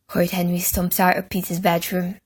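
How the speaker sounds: ordinary delivery, nothing marked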